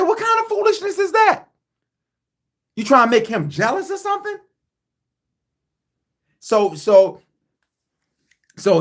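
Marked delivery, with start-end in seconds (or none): 0.0-4.4 shrill disbelief, words rushing; incredulous high yell, getting faster; outraged high-pitched yell. Retold or imitated. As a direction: demand it, pitched way up and racing. Yelling at her in retold argument.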